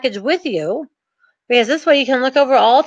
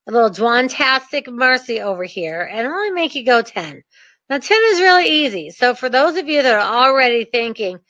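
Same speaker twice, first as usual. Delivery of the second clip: usual speaking voice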